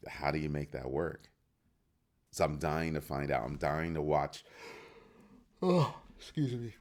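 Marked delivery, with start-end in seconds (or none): 5.6-6.7 words stretched through a yawn. Real, not acted. Sleepy vlogger apologizing through a yawn. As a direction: say it mid-yawn, high. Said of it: "I'm yawning again".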